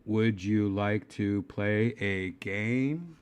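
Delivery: slow, word-by-word monotone question; movie computer voice. Acted. User quoting War Games to the assistant, slow computer-like delivery. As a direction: intone it slowly like a computer.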